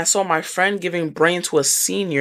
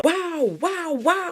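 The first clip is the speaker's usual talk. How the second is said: stunned; 10 semitones higher, 4 dB quieter, much slower than usual. Real, not acted. Stunned reaction.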